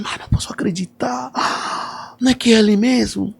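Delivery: high, gasping disbelief; a woman who can't believe her eyes, gasping; astonished disbelief. Retold or imitated. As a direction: play her stunned, gasping at who's at the door. Stunned woman seeing the singer.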